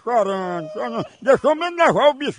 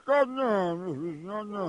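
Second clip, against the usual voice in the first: softer, low soothing murmur; quiet the fussing baby gently; hushing a baby; 4 semitones lower, 8 dB quieter than usual. Acted. Prank host lightly hushing the fake baby for the neighbour to hear.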